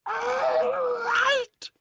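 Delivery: very high, loud crying shriek; shrieking old hag. Acted. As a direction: screech it like a furious crone. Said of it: "she yelled".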